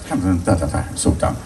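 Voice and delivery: ordinary talk, nothing marked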